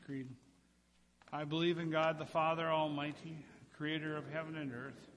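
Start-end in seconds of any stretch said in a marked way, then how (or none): none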